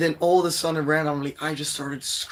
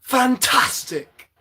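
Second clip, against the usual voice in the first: high, loud, drawn-out exclamation; exclaiming; 9 semitones higher, 6 dB louder, much slower than usual. Real, not acted. Mock celebration after voice attempt.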